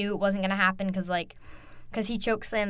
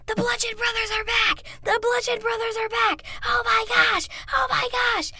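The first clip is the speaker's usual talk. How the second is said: very high, frenzied fan scream; re-enacted screaming; 12 semitones higher than usual. Fan relives a surprise wrestling return, screaming. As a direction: scream it out like an ecstatic fan in the crowd. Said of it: "And then I'm screaming".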